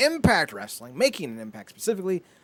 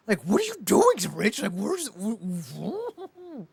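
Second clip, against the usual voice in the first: incredulous outburst; 6 semitones higher, slower than usual. Retold or imitated. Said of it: "and I'm like".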